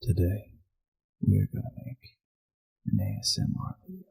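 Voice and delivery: hushed ASMR intro, fading to a whisper; soft and low, trailing into whisper; ASMR attempt. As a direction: murmur it like an ASMR video opener. Let me try again.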